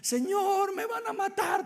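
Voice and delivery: high, fearful whimper; whiny complaining. Acted. A scared believer complaining to God, whiny. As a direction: whine it like a frightened believer. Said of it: "Usted se mantiene llorando, quejándose".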